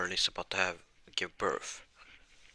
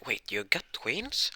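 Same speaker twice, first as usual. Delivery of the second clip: slow, higher astonished question; surprised question; 5 semitones higher, slower than usual. Acted. Elizabeth and Meliodas learn of the twins, surprised. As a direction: draw out the question, pitch jumping up in surprise.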